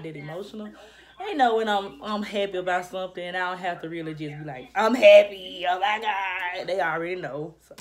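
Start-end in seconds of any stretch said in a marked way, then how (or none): 4.7-6.7 a burst of loud, mock-excited gushing. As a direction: ham up an over-the-top happy squeal, half-joking. Mocking an over-emotional reaction.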